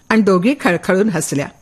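plain reading voice

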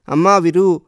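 a narrated wail for his daughter, understated; wailing cry. A narrator's reading. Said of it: "భోరున విలిపించాడు".

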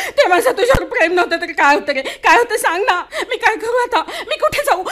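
loud, tearful, rising desperation; anguished loud plea. Acted. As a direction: cry out at him, begging where to go. Wife pleading with leaving husband. (नवऱ्याला रडत मोठ्याने विचारणारी, आर्त विनवणी)

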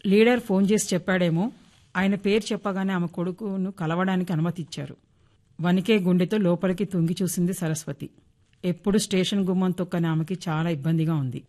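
plain reading voice

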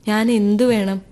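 plain reading voice

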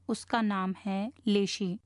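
slow, measured repeat with لیشی set out clearly; deliberate repetition. Shown as a demonstration. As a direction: repeat it slowly so that the name لیشی sinks in. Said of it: "میں آپ کے لیے دہرا دوں". (ٹھہر ٹھہر کر دہراتے ہوئے، لیشی نام واضح)

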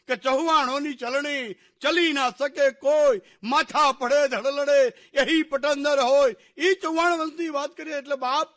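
loud, ringing folk-bard declamation; rousing declamation at full voice; bardic storytelling. A narrator's reading. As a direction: proclaim the clan's glory like a folk bard. Bardic praise of Chauhan clan.

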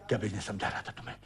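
very low, soft and rapid; stunned muttering. Acted. Father questioning his son, low hurried mutter. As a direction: let the question slip out under the breath. First take, under his breath.

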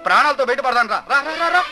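high, frantic shouts of alarm; panicked shouting. Acted. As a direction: yell in panic as the house burns. House catching fire, panic. (ఇల్లు కాలుతుంటే భయంతో గట్టిగా అరుపులు)

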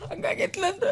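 breaking into a soft, high sob; tearful lament. Acted. Lamenting her hard life, then consoled.

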